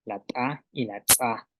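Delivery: "ta" and "tsa" with ejective first consonants; glottalised T, TS. Shown as a demonstration. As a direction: pop the t of "ta" and ts of "tsa" before each vowel. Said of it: "que llevan glotal".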